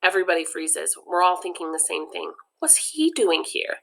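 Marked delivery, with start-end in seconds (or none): none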